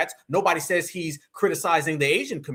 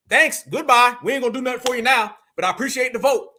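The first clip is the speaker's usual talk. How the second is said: a bit higher, with a laugh, a breezy brush-off and a fast middle part; mock dismissive politician; 5 semitones higher, 4 dB louder than usual. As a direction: shrug them off like a politician who no longer needs you. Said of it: "then they just say".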